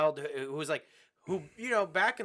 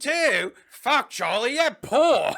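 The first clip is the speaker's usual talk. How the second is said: loud, high mocking yell; hammed-up teacher outburst; incredulous teacher; 8 semitones higher, 10 dB louder, much slower than usual. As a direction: berate the kid in a put-on voice. Teacher's outraged reaction, acted.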